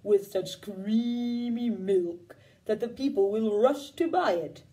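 low, slow, lumbering cow. Acted. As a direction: speak like a worried old cow, dragging each word. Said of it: "asked the cow".

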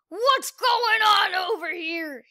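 loud, slow, gruff yell. Acted. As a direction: bellow it from the doorway. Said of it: "yelled Lawrence Quinn".

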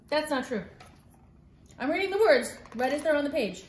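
plain reading voice

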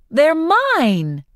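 high-pitched possessive cry; playful protest. A narrator's reading. Woman swiping for her candy bag, indignant. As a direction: protest it high, claiming the sweets.

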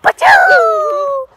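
loud high fake sneeze. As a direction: do a big hammy sneeze.

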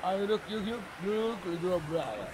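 ordinary delivery

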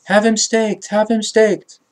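restrained, repeated high outcry; mock crowd scream. A narrator's reading. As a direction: cry it out high like a crowd, without full volume. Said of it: "screamed even louder and called out".

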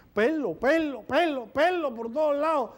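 slow, pleading repeat of pelo; insistent complaint. Acted. Patient shows his hairy body. (paciente enseñando el pelo al doctor, insistente y lento)

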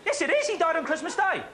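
high-pitched, indignant rebuttal; excitable defence of the answer; insistent protest. Real, not acted. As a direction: insist you are right, voice jumping up. Host contradicts doubt about the answer.

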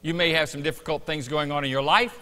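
preaching. Acted. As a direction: build it up like a preacher. Preaches before communion.

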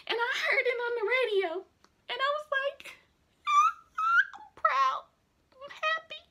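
joy spilling into sobs; laughing through tears. Real, not acted. Overjoyed, laughing and crying.

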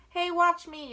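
put-on loud, high boyish yell; showing-off storybook yell. A narrator's reading. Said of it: "yelled Avery".

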